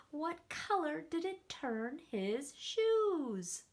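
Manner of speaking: ordinary delivery, nothing marked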